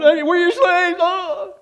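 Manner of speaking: loud, high pleading of the brothers; pleading cry. Retold or imitated. Brothers groveling before Joseph, loud high plea. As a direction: throw yourself down and beg. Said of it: "threw themselves down".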